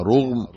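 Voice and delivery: "رغم" said rughm, slowly, with short u after ر; damma on ra. Shown as a demonstration. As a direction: say "رغم" with a rounded u on the ر. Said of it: "وبضمها".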